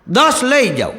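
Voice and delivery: a hint of a cheeky street vendor; mock vendor's pitch. Vendor bargaining with the sahib.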